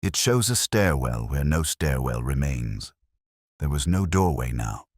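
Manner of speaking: plain reading voice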